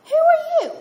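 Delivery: sharp high affronted "Who are you?"; mock affronted cry; indignant inner shock. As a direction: recoil in offended disbelief, loud. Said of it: "And you think to yourself".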